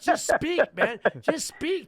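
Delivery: loud, high exasperated outburst; exasperated plea. Acted. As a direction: plead loudly with them to drop the act. Exasperated at people forcing accents.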